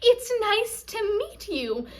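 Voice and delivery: high, slow gushing imitation; mock-delighted, drawn-out introduction; over-happy greeter. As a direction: stretch it out like an overeager greeter. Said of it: "She's coming in like".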